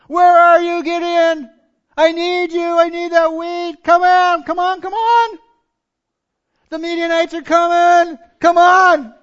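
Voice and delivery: high, loud hollering for a missing son; hammed-up panicked dad yelling down the phone; frantic calling out. Acts Gideon's dad calling his phone.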